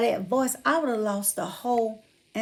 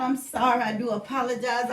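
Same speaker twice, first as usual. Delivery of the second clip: ordinary talk, nothing marked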